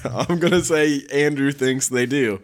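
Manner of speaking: high and loud through laughter; laughing. Real, not acted. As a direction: tease while still laughing.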